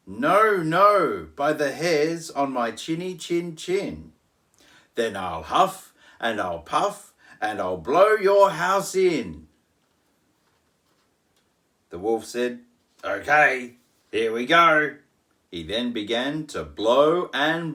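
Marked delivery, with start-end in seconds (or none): 0.1-4.1 high, loud squeaky pig refusing; little pig voice. Acted. Pig shouting no through the door. 5.0-9.5 loud menacing wolf threat. Acted. Wolf threatening the pig, loud and menacing. 13.0-15.0 louder wolf psyching himself up; wolf gearing up. Acted. Wolf about to blow the house, eager.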